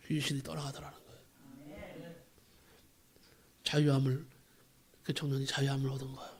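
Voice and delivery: ordinary talk, nothing marked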